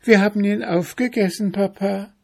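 put-on very high, quick child voice; little bear cubs. A narrator's reading. Cubs confessing to their father, high quick child voice. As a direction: pipe up fast like a small child.

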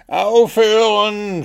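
high, loud, drawn-out cry to stop; desperate scream. A narrator's reading. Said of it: "schrie Wuschel in seiner Verzweiflung". (verzweifelter, langgezogener Schrei)

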